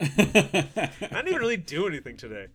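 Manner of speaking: laughing through the words; chuckling while saying it. Real, not acted. Laughing at own tiredness.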